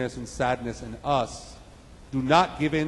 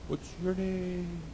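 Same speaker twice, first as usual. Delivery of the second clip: soft, slow, higher echo; distant echo; 4 semitones higher, 9 dB quieter, slower than usual. Acted. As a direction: echo it back from far away. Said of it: "about the echo".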